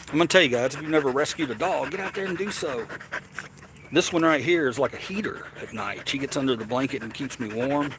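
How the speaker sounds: normal talking voice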